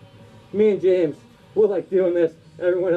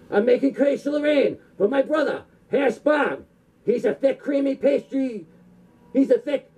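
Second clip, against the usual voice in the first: hammed-up dubbed kung fu film voice, higher; kung fu movie villain; 4 semitones higher than usual. Comic sketch parodying a dubbed kung fu film, hammed-up villain voice. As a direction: ham it up like a badly dubbed martial arts movie.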